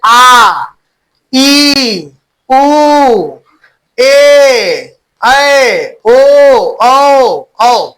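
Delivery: each vowel from आ to औ held long. Shown as a demonstration. Teacher demonstrating two-beat vowels, आ, ई through औ drawn out. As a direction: sustain every vowel for a double beat. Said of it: "दो मात्रा का समय लगता है".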